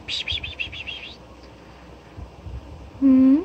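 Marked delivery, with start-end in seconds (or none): 0.1-1.2 soft unvoiced psst calling; whispered psst calls. Real, not acted. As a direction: hiss psst to call the pet.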